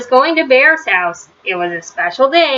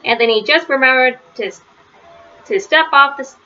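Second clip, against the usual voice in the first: ordinary delivery, nothing marked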